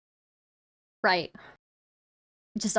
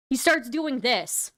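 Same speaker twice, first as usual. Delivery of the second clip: ordinary talk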